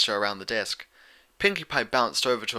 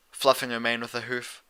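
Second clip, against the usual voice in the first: usual speaking voice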